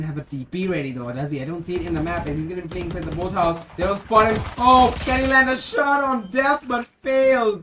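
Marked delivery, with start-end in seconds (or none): 3.8-7.6 excited call. Real, not acted. Caster calls a tense duel.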